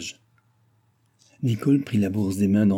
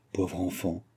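softly sighed words, much quieter; understated sigh; 2 semitones lower, 8 dB quieter, slower than usual. A narrator's reading. André sighs over poor Nicole, softly sighing. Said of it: "soupira-t-elle".

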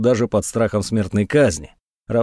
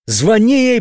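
high, louder urgent cry; almost shouting; 9 semitones higher, 4 dB louder, slower than usual. A narrator's reading. Dobrodeev urges the monk to call her, near shout. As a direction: nearly yell it in panic. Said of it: "почти закричал Добродеев".

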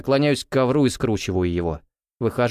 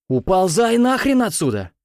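high angry yell; 9 semitones higher, 3 dB louder than usual. Acted. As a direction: shout him out. Kicking out drunk guy.

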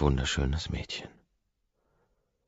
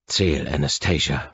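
stern demand; 5 dB louder than usual. A narrator's reading. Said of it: "fordere ich".

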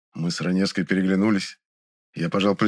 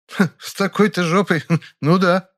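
higher, with a short laugh; guffawing; 9 semitones higher, 3 dB louder than usual. A narrator's reading. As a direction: snort a laugh through the words. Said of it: "хохотнула Раневская". (хохотнув)

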